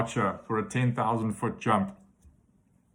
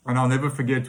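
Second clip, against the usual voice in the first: ordinary talk, nothing marked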